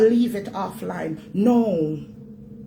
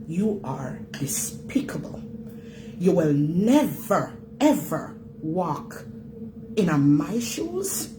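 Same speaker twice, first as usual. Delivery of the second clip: cold contempt; 4 semitones lower, 4 dB quieter, slower than usual. Real, not acted. Slow contemptuous condemnation.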